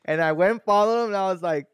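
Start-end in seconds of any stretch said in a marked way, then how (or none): none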